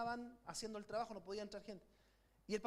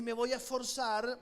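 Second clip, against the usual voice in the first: leading a loud declaration; 7 dB louder than usual. Shown as a demonstration. Pastor leading the church in a bold declaration. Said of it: "Vamos, dilo fuerte".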